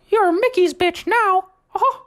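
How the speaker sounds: high squeaky Mickey taunt; Mickey Mouse voice. Retold or imitated. Mickey Mouse taunting the new guy, squeaky and smug. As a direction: sneer it in a high cartoon mouse voice. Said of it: "he just looked at me and said".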